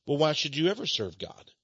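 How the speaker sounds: ordinary delivery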